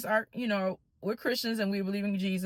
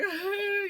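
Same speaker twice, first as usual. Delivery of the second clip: high-pitched imitated wail; mock crying noise; boohooing; 12 semitones higher than usual. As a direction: fake sob, drawn out. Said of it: "I'm boohooing".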